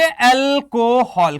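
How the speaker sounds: alcohol stretched slowly, like answering for the class; alcohol drawn out long and slow, modelling the answer. Shown as a demonstration. Teacher models the class's answer to his question, slow and drawn out. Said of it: "इसको हम क्या नाम देंगे?".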